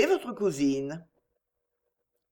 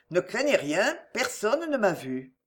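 ordinary delivery

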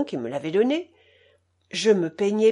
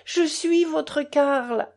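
emotional reveal; 7 semitones higher, much slower than usual. A narrator's reading. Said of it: "criai-je".

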